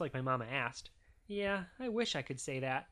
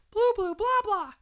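put-on very high, loud baby babble; babbling baby; 10 dB louder than usual. A narrator's reading. Baby Maggie copying her family, high loud babbling. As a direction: squeal out nonsense syllables like a baby. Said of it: "And Maggie said".

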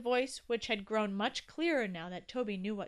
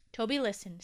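plain reading voice

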